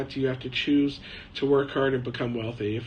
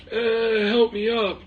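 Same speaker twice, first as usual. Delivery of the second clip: mock plaintive old man, higher-pitched; imitated old man; 8 semitones higher, slower than usual. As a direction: imitate an old scammer groaning for help. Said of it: "he's doing it with his voice".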